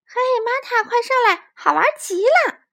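high, loud gleeful call; delighted child. Acted. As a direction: call friend up, thrilled.

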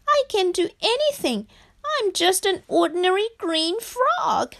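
high-pitched wailing frog character; mock sobbing. A narrator's reading. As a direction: lament as a tearful little frog, acted. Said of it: "he sobbed".

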